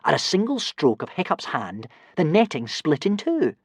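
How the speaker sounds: plain reading voice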